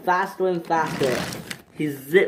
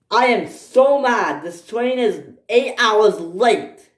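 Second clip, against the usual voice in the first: slow, emphatic higher-pitched complaint; angry shout; 6 semitones higher, 3 dB louder, slower than usual. Acted. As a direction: spell out the outrage, slowly, voice raised. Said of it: "he shouted".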